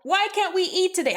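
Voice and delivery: high, quick put-on question; mock complainer. Subjects looking to blame Margaret, high mock complaint. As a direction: pitch it up like a petulant subject.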